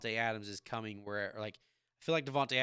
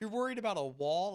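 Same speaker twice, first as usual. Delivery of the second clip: higher, mocking disbelief; incredulous; 9 semitones higher than usual. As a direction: tease her with exaggerated disbelief. Dad mocking daughter's wall drama.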